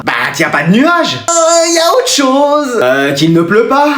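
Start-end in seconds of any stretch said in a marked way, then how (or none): 1.3-2.8 coaxing teacher. Acted. Teacher coaxing a slow answer.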